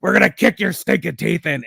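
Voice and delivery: gruff wrestler promo growl; deep gravelly voice. Retold or imitated. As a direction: growl it like a tough eighties wrestler. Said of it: "Axe had a deep, gravelly voice like this".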